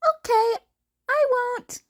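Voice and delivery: put-on high, bright boyish voice; a high, cheerful boyish okay; cheery promise. Promising Eraser.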